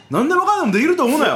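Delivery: fast, high, laughing warning; a playful jab at the audience; mock scolding.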